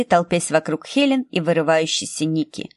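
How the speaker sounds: ordinary delivery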